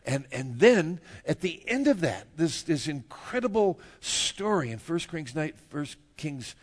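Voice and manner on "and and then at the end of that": ordinary talk, nothing marked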